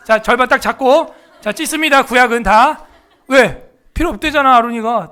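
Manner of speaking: loud, playful call to tear it up; hammed-up rallying of the class; mock rallying shout. Mock tearing the Bible.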